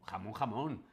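usual speaking voice

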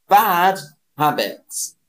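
"bad habits" slow, "bad" hit hard; stress on bad. Shown as a demonstration. Teacher modelling "bad habits" for learners, stress on "bad". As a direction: lean on "bad", then fall on "habits". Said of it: "teacher models phrase".

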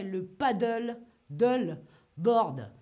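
English word said with a French accent, piece by piece. Shown as a demonstration. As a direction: break up "paddle, dull, board" with a French accent, punching each part. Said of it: "toujours avec ce petit accent français".

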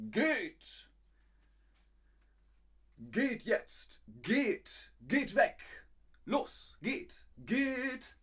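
high, loud barked orders; commanding. Shown as a demonstration. Teacher demonstrates the imperative to a group, sharp high commands. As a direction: shoo a group away insistently.

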